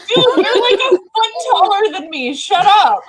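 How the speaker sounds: high, loud laughing protest; laughing outburst. Real, not acted. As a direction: blurt it out, laughing and incredulous.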